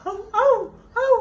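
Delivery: weak puppy yip. Pretend. Voicing the dog's weak bark.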